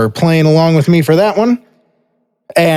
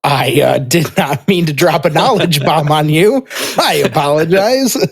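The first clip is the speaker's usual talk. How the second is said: higher-pitched, laughing through the apology; 4 semitones higher than usual. Real, not acted. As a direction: apologize while trying not to laugh. Amused apology for breaking sad news.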